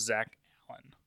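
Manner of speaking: real voice loss, last word barely there; voice going out. Real, not acted. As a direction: voice gives out on the surname. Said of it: "Sorry for my voice going out".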